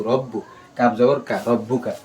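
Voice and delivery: ordinary delivery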